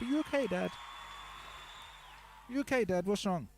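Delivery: put-on a small boy checking on his swearing dad; young son's worried voice. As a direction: ask gently as a little son. Son checking on upset dad.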